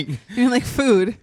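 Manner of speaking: laughing as she repeats the friend's joke; laughing echo. Retold or imitated. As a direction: repeat the joke while cracking up.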